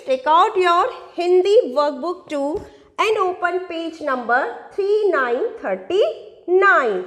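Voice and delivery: very slow, spaced-out instruction; slow dictation. Real, not acted. Teacher tells kids which workbook page to open, very slow dictation. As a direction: spell out the page number slowly, phrase by phrase.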